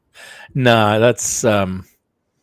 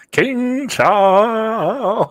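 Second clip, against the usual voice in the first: very slow, higher and louder, stretched like a herald; mock royal announcement; 12 semitones higher, 7 dB louder, much slower than usual. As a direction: trumpet out the royal name. Joking grand title added.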